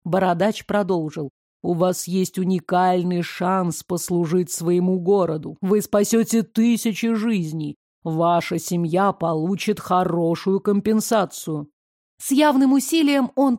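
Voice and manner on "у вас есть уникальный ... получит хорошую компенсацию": low, measured bearded man; говорить низко, солидно и размеренно; low official voice. Acted. (низкий солидный голос бородача)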